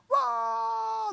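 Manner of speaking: huge high shout of amazement; amazed shout. Retold or imitated. Adults in the room react to a regrown finger with a shout. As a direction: belt out a stunned whoa.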